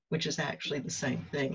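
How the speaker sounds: ordinary delivery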